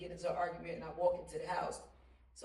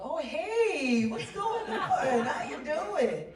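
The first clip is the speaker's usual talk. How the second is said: slow, high, smooth and friendly greeting; a silky put-on phone greeting; smooth caller voice; 10 semitones higher, 11 dB louder, much slower than usual. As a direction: drawl it out like a charmer on the phone. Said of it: "I could change my".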